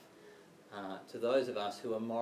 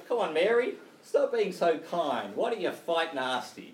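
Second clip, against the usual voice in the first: put-on loud, high parent calling to a child; hammed-up parental scolding, raised voice; mock parent; 6 semitones higher, 9 dB louder than usual. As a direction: lay down the law like a parent, tongue in cheek. Mock parent urging child to misbehave.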